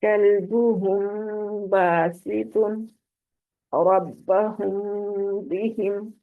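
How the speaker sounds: slow chant, "कल्बुहुम" closing on a long hum; ghunna in recitation. Shown as a demonstration. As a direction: hold the meem of "रब्बहुम" through the nose before "बिहिम". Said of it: "दो हरकत जितना पूरा करना है".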